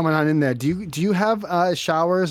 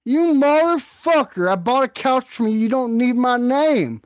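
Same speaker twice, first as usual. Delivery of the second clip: angry yelling husband; 10 semitones higher, 4 dB louder than usual. Pretend. Angry husband yelling at couch seller on the phone, loud and furious. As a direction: yell it much higher and louder.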